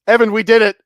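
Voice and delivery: high, loud victory cry; mock triumphant cheer. Imitating friend after the game crashed, triumphant. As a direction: shout it like you just won.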